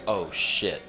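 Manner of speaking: low, soft retold "uh-oh" reaction; hushed alarm. Onlooker in the car watching a dog get kicked, acted hushed alarm. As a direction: drop right down, as if not to be overheard.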